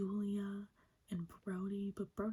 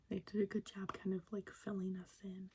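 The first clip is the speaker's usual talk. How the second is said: ordinary talk, nothing marked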